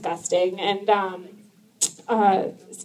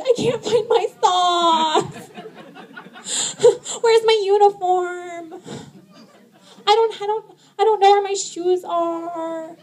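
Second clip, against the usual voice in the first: high, slow, whiny helpless man; high slow whining husband who can't find socks; whiny husband impression; 9 semitones higher, slower than usual. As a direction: whine like a lost husband.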